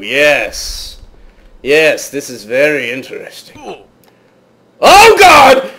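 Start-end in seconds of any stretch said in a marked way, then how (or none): 4.8-5.7 high, loud yelp of alarm; startled shout. Real, not acted. Gamer surprised by a zombie, startled shout. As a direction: cry out in sudden shock.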